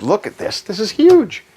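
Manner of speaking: high-pitched amazement; amazed. Real, not acted. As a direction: marvel at it, voice leaping up high. Showing off the storage space.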